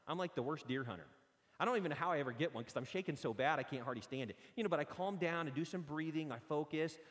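ordinary talk, nothing marked

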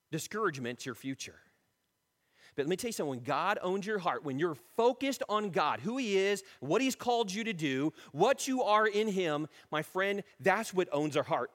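usual speaking voice

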